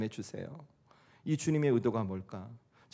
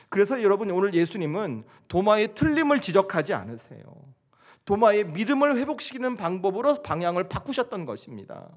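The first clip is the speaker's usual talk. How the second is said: raised, higher-pitched sermon delivery; impassioned preaching; 8 semitones higher, 6 dB louder than usual. Acted. Pastor telling congregation of love behind the wounds, impassioned. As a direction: drive the message home with fervour.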